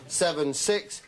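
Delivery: normal talking voice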